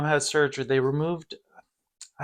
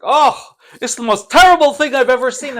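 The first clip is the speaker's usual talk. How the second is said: higher and louder mock dad voice; blunt dad; 10 semitones higher, 9 dB louder than usual. Dad coming out of the bathroom, mock blunt shout. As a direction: play the tactless father blurting it out. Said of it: "and he's like".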